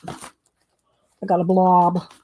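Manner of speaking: slow, drawn-out "I got a blob"; "I got a blob" said very slowly, with "blob" held long; drawn-out dismay. Real, not acted. Repeats the line about the paint.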